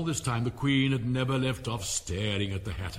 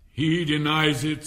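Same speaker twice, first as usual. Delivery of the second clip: louder and slow, royal declaration; king's ruling; 4 semitones higher, 9 dB louder, slower than usual. Acted. King tells the court the Hare denies it, slow and loud. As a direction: announce it slowly to the court. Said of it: "said the king".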